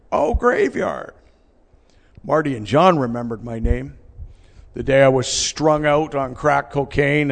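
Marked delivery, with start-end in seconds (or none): 0.1-1.1 high, drawn-out realization; slow "oh, him" in a raised voice; sudden recognition. Retold or imitated. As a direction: imitate someone finally catching on. Friend realizes who Steve is.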